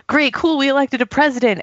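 high, breezy mock cheer; chirpy approval. Speaker voicing Americans happy to have elected a president, chirpy.

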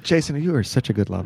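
mock sultry voice. Mock wife praising lover.

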